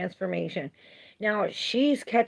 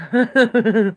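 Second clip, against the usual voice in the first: loud, laughing disbelief; incredulous; 3 semitones higher, 10 dB louder than usual. Real, not acted. Asking viewers if she looks British, loud. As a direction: punch it out in disbelief.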